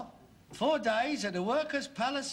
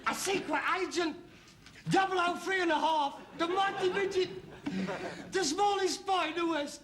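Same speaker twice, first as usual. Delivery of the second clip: high, hammed-up heroic billing; mock spy announcer; 9 semitones higher than usual. Son ridiculing father as tiny spy, mock-dramatic billing. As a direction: tease with a grand secret-agent introduction.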